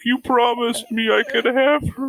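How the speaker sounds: high, whining complaint; whiny janitor. Retold or imitated. Janitor whining to Satan, high whine. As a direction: whine like a cheated creep. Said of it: "And he's like".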